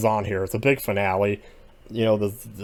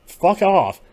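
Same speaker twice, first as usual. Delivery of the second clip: high, loud, dismissive snap; mock fuck off; 10 semitones higher, 7 dB louder than usual. Fan mimicking the Others' rude brush-off, loud and high. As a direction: bark it as the Others brushing him off.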